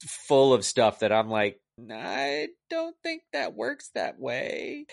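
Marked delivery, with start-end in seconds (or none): 1.9-4.9 very high, soft and slow; exaggerated doubt. Reviewer doubting the movie's logic, exaggerated doubt. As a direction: draw out the sceptical objection in a small high voice.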